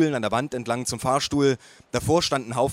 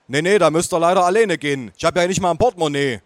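higher, louder, brisk refusal; stubborn grandpa; 4 semitones higher, 5 dB louder than usual. A narrator's reading. Grandpa refusing to go to dinner, louder stubborn refusal. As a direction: wave them off, a stubborn old man.

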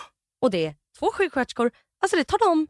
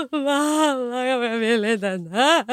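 slow, drawn-out wail for mother; mock-sobbing plea, stretched out; crying out; much slower than usual. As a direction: imitate your own terrified wail. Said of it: "jag skriker, jag bara".

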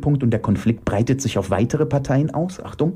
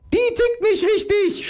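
very high, venomous screech. Acted. Iris spitting at Elke, venomous. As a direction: spit it out at a very high pitch. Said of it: "spie Iris giftig".

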